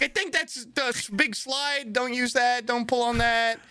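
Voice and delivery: high, put-on rookie voice; nervous stewardess. New stewardess points out the big slide, nervous. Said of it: "She was very nervous feeling".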